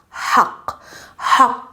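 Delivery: "haq" begun on a voiceless rush of air; breathy Arabic ha. Shown as a demonstration. Teacher models the letter in the word, breathy voiceless ha. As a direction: push air out to open each "haq". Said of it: "the letter ha in the word".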